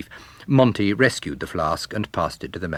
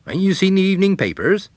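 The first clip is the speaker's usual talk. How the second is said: higher, louder gruff query; sergeant; 11 semitones higher, 5 dB louder than usual. A narrator's reading. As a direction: ask it like a blunt country sergeant. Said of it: "the sergeant asked".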